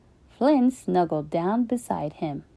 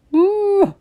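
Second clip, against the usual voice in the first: high loud creature boo; hammed-up creature cry; walrus boo; 12 semitones higher, 6 dB louder than usual. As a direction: blurt it like a cheeky walrus. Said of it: "said Walrus".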